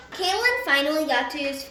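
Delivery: ordinary delivery, nothing marked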